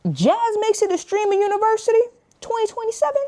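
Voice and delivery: hyped announcement. Real, not acted.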